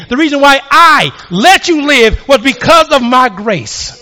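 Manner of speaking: high, emphatic refrain building line by line; forceful preaching. Acted. God answering the woman why she lived, preached high and forcefully.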